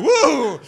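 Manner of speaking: loud, high mock "whoa"; sudden rollercoaster-style whoop; startled whoop. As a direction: whoop as the plane drops. Mimics passengers as plane drops.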